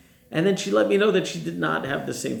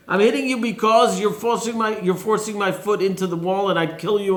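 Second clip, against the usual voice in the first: higher, louder furious rider; angry yell; 6 semitones higher, 4 dB louder than usual. Acted. Bilam yelling at his donkey, angry shout.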